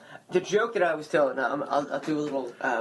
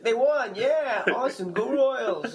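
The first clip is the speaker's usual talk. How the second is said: cheering fan; 9 semitones higher, slower than usual. Retold or imitated. Walking to his car, he cheers back at Cardinals fans, mock excited. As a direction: hype it up like a happy fan leaving the game.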